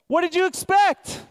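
high, loud, mock-amazed; incredulous retort. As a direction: pastor throwing a rhetorical jab at churchgoers, incredulous. Preacher retorts to imagined objection.

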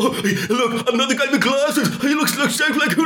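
high, silly mimicry of dumb fans; jokey mocking voice. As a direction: make fun of them in a squealing, giggly put-on voice. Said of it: "I did this jokey voice".

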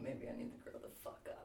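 whispered to herself, fast; whispering. Real, not acted. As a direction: breathe it out as a quick aside. Self-scolding aside, unvoiced.

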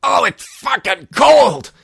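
high, slow, shrieking complaint; drag persona catchphrase. Pretend. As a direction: shriek it like a freezing diva. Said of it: "That's my catchphrase".

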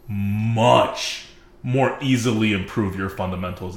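"much" leaned on, the whole phrase slowed; slow down and press hard on "much"; stress on much. Shown as a demonstration. Telling viewers the slow meta builds skill, slow with "much" pressed. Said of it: "I'm gonna stress this word here".

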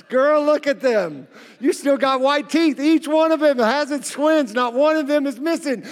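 loud, high, teasing praise; playful hype. Pretend. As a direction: hype her up like a flirty compliment. Preacher hyping the bride's teeth.

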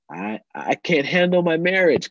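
high, pleading mock complaint; overwhelmed protest. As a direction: protest like someone at their wit's end. Voicing overwhelmed believers to Jesus.

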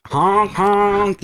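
high honking horn imitation; truck horn honk. Shown as a demonstration. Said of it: "The air horn?".

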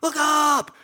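high, loud shout; buddy shouting at a burning treetop; retold warning yell. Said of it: "I hear him yelling".

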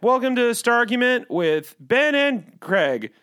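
lightly mocking Star Wars podcast host; podcast host impression. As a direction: open a nerdy podcast with fake energy. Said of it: "I could do something like".

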